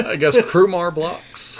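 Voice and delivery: giggling as the words come out; amused, laugh in the voice. Real, not acted. Laughing at a useless blocker.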